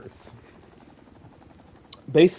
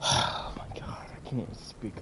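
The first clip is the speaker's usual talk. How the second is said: speechless whisper; 3 semitones lower, 8 dB quieter than usual. Real, not acted. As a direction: whisper as if the breath is gone. Speechless after seeing the clips.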